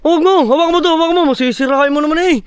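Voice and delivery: high, rushed-sounding protest; urgent warning. Real, not acted. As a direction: stop your helper before it breaks. Urgent warning as helper may break something. (nagmamadaling pagsaway)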